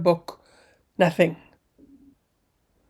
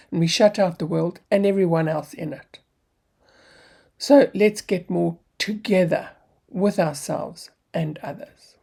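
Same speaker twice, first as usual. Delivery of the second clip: normal talking voice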